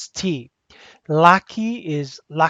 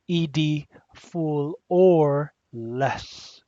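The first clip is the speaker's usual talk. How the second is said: drawn-out reminder; much slower than usual. Shown as a demonstration. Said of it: "another one to remember".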